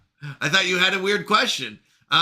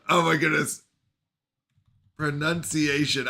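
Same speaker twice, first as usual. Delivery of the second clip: ordinary talk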